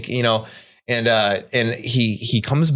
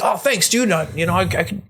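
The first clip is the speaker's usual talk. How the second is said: high, offhand grateful Greg; a quick, careless high thanks; Greg thanking; 8 semitones higher than usual. Retold or imitated. Greg thanking his friend for the comics, high and offhand. Greg voiced in retold story.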